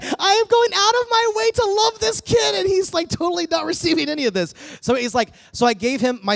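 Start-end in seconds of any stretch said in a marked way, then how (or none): 0.0-4.5 high, loud exasperated rant; mad and aggravated. Retold or imitated. Preacher venting about a kid rejecting his prayer, loud and exasperated. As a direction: vent the frustration full blast, pitch way up. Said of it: "I was so mad and aggravated".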